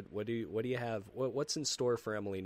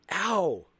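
mock ouch; 8 semitones higher, 7 dB louder than usual. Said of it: "you wake up and you're like".

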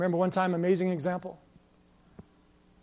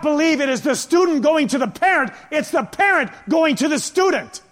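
loud, raised preacher's voice; forceful preaching; 6 semitones higher, 9 dB louder than usual. Real, not acted. Preacher urging people to invest in others, forceful preaching. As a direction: press each point home to a congregation.

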